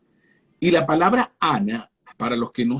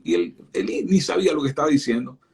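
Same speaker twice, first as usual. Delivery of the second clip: ordinary delivery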